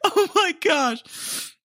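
high, drawn-out gasp through laughter; breathless and helpless with laughter; laughing. Real, not acted. Recovering from the gunshot joke, still laughing. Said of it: "I'm dying".

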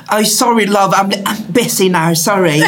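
polite British. Retold or imitated. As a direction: imitate an apologetic British drag queen. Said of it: "Också så här på artigt brittiska".

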